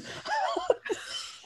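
high breathy laugh. Real, not acted. Laughs at own story.

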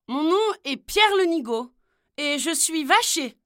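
voix plus aiguë et forte, fière et simple; présente-toi avec candeur; Pierre naïf. Acted. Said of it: "répondit Pierre". (jeune vacher naïf répondant aux princesses)

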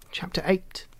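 usual speaking voice

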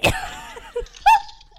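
high burst of laughter. Real, not acted. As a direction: squeal of laughter. Prank call breaking into laughter.

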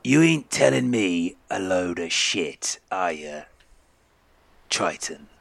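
slow, deliberate, voiced threat; menacing captain. Captain repeating his threat to the triton, slow and menacing. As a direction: spell out the warning slowly.

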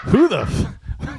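about to shout but laughing; laughing cry. Real, not acted. Husband laughing at wife's nagging, laughing.

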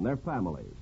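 normal talking voice

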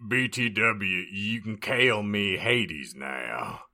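lightly voiced old-timer; Wilford Brimley impression. Sirius renaming himself to the heroine, light Brimley impression. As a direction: do a slow, drawn-out Brimley. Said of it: "we're just going Brimley".

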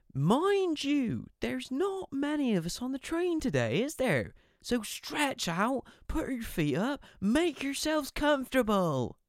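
smarmy guard inviting passengers to relax; sarcastic train announcer. Retold or imitated. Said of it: "he was being sarcastic".

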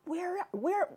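high, soft puzzled searching; retold bafflement.

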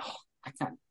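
breathy whispered reply; whispered quote. Retold or imitated. Retelling the couple's reaction, a soft whisper. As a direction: whisper it, as if retelling Libby and Drew's quiet answer. Libby and Drew's hushed reply.

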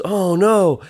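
lightly acted dismay; dismayed passer-by. A young stranger reacts to the hat landing in the puddle, dismayed.

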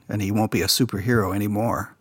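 ordinary talk

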